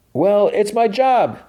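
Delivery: very high, drawn-out retort; imitated defensive cry; protesting. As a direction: insist on it from behind the door. Solo protesting through the door.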